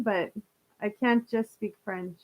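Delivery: ordinary delivery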